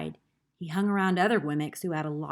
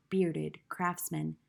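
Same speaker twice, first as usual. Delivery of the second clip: plain reading voice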